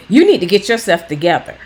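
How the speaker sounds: retold scolding yell at the depressed person; mock yell. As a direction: bark it like a fed-up carer. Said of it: "you don't yell at them".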